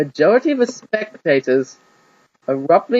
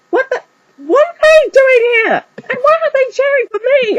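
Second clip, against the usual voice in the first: much higher, louder, bewildered demand; demanding boy; 6 dB louder than usual. Acted. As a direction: demand answers in disbelief. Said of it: "Ash demanded".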